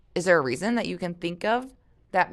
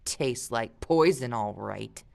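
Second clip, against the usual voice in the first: sour glare; 6 semitones lower, 3 dB quieter, slower than usual. A narrator's reading. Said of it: "glared at Luke".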